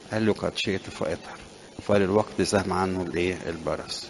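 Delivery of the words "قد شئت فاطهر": quiet, gentle reply from Jesus; hushed reverent quote; softly spoken divine answer. Acted. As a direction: say it softly, like a healing word.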